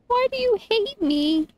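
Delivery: high mock-hurt whine; whiny. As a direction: whine like you're wronged. Whining at the game.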